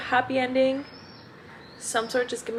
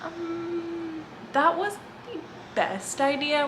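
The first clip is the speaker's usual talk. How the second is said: higher, lightly sarcastic; mock sarcasm; 9 semitones higher, 4 dB quieter, slower than usual. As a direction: say it with a wry fake cheer. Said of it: "we're like".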